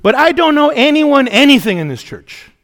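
mock the annoyed man's outburst; annoyed retort. Churchgoer complaining to the pastor after service, loud and annoyed. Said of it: "very annoyed".

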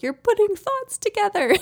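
high-pitched mock-amazed parent; gushing baby-talk wonder; delighted marvelling. Mother marvelling at toddler's sentences.

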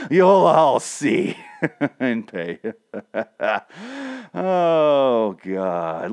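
slow villain laugh line; wild unhinged ranting. Acted. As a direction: cackle out a mock threat. Teasing a secret threat.

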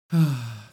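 airy little sigh; wistful sigh. Real, not acted. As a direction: sigh with fond delight. Delight over morbid lyric.

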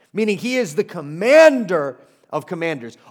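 preacher's ringing "commander of commanders"; emphatic proclamation. Real, not acted. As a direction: proclaim it with force. Proclaiming Jesus' authority.

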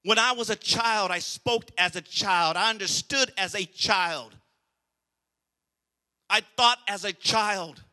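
slow and deliberate, every word weighed; slow preaching. Acted. Preacher quoting scripture to the church, slow and emphatic. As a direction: lay each phrase down slowly.

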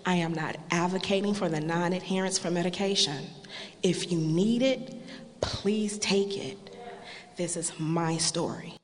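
loud testimony to the congregation; full-voiced closing of a testimony; preaching at full force. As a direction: proclaim it to the church.

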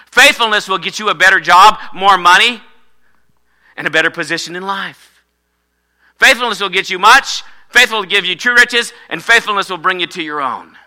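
loud, high preacher's cadence; full-force preaching. Acted. Preacher exhorts the church on faithfulness, loud. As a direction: drive it home to the congregation.

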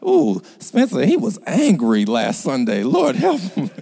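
high, loud playful teasing. Real, not acted. Preacher teasing about Spencer being angry last Sunday. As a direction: tease him with a laugh in the voice.

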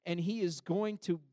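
high, loud, impassioned sermon voice; fiery preaching. Acted. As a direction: preach it at full force. Preaching at full force.

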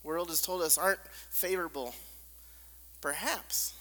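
ordinary talk, nothing marked

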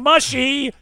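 high, loud mock yell of the word. Listener imitating a host yelling at a camera, loud mock yell. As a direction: belt it out like a cranky camera guru. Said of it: "hears Jeff's voice in his head yelling".

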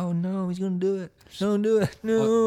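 high, pleading no; panicked pleading. Retold or imitated. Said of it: "I was like".

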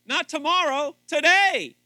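loud, high climax of a sermon; preaching. Real, not acted. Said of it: "I'm getting a little preachy there".

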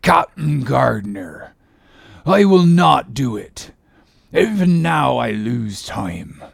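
slow, every word drawn out; slow deliberate voice. Acted. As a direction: mould each word slowly. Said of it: "in a voice that prolongingly molded every word".

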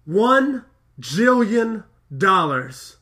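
Fry shouting out his huge auction bid, slow and loud; proud bid. Retold or imitated. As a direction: announce it grandly and slowly. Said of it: "I love how Fry goes".

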